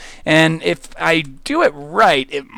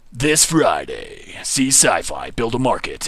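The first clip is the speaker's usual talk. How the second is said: low, slow movie trailer announcer; deep, drawn-out cinema trailer narration; 6 semitones lower, 4 dB quieter, slower than usual. Pretend. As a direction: sell it like a dramatic coming-soon ad. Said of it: "I'm really loving the movie theater voice".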